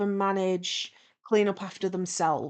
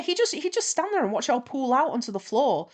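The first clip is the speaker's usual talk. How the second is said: ordinary delivery, nothing marked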